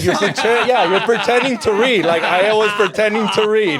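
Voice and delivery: laughing. Real, not acted. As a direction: giggle through the words. Laughing about faking reading.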